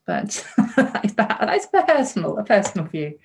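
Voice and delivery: giggling through the words; laughing. Real, not acted. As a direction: laugh it off lightly. Laughs through her personal opinion.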